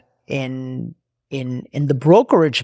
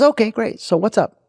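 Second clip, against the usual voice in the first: imitated chipper reply, higher pitch; happy agent; 8 semitones higher than usual. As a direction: play an unsuspecting, eager-to-please employee. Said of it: "he's gonna be happy".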